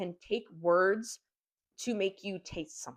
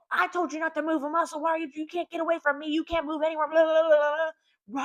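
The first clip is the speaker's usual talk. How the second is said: much higher, fast, shrill-free mock scolding; berating mother; 11 semitones higher, 6 dB louder than usual. Mother scolding her leashed son Donnie, much higher and fast. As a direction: tear into the kid like a controlling mom. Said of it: "she's like".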